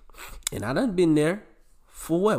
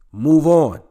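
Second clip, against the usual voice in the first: loud move on; 3 semitones higher, 11 dB louder than usual. Real, not acted. Host yelling at his listeners to move on. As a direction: shout it, much louder.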